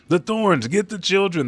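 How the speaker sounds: high, loud warning cry; acted alarm. Warning that thorns are falling on children, alarmed.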